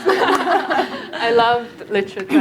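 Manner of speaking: drawn out slowly through a laugh. Real, not acted. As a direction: let the laugh carry the words. Laughs while contrasting with colleague.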